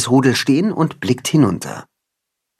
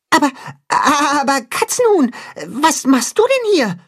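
much higher, stammering little goat voice; a baffled kid caught at play; puzzled; 13 semitones higher than usual. Acted. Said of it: "fragt Tacho verdutzt".

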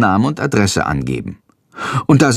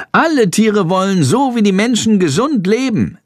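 higher chattering bird voice; plappernd; 9 semitones higher than usual. A narrator's reading. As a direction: babble it out eagerly. Schandor continues. (Schandor plappert Jendrik zu: hohe, plappernde Vogelstimme)

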